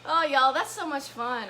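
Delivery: ordinary delivery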